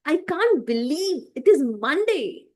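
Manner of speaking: excited. Shown as a demonstration. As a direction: sound thrilled it's Monday. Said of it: "I'm excited right now".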